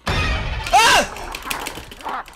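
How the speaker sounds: high gasping yelp; sudden sharp cry of fright; startled gasp. Real, not acted. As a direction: jump and cry out. Startled in game.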